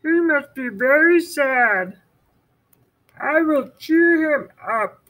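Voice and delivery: high, sympathetic Toad; Toad's voice. Acted. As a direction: pity your friend in a high voice. Toad continues.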